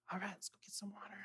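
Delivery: breathless whispered mutter; sleepy whisper. Pretend. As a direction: whisper it like you just woke up. Servant waking at dawn.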